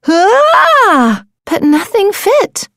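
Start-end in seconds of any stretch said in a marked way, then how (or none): 0.1-1.2 high, louder cry; startled shout. Acted. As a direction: yelp in alarm. Another bad shell.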